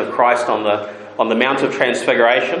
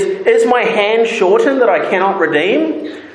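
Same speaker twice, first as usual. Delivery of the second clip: pleading God; 9 semitones higher than usual. Acted. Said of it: "He says".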